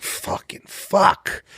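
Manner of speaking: slow breathy whisper, drawn out; whispered curse. Retold or imitated. Comic hearing he's cut from the update, whispered curse. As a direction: curse under your breath in despair. Retold private reaction, whispered.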